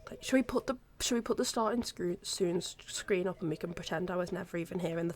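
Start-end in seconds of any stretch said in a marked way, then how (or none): none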